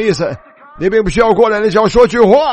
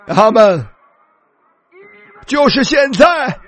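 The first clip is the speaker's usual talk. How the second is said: slow, high, building shout of just now; rising preaching cry; 5 semitones higher, slower than usual. Real, not acted. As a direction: cry it out, stretching each word. Preacher cries out climax. (拉长高声喊出“就是现在”)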